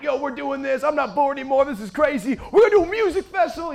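high-pitched excited outburst, building; freaking out. Retold or imitated. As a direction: blurt the big plan, pitch climbing. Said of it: "So I'm freaking out".

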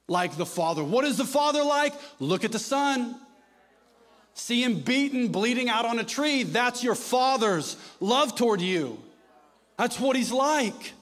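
impassioned preacher crying out; preaching at full force. Acted. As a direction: drive it home to the congregation. Preaching climax on God's love.

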